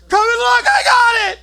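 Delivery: high, loud victory yell; triumphant yelling. Retold or imitated. Narrator yelling his catch to the house. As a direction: scream it like you just landed a whopper catfish. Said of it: "I start yelling and jumping around".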